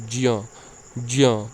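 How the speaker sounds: ज्य said as half ज glided into य, gya; ज्य, ज्य said carefully as j plus ya; pron demo. Shown as a demonstration. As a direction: sound out ज्य as ज joined to य. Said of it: "आधा ज plus आधा इय".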